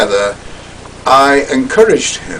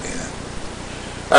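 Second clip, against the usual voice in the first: ordinary delivery, nothing marked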